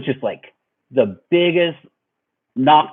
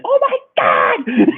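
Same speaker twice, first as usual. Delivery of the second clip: high-pitched, drawn-out mock shriek; mom freaking out; 3 dB louder, slower than usual. Mom seeing him covered in blood, mock freak-out. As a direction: imitate a panicking mom, laughing after. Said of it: "The mom freaks out".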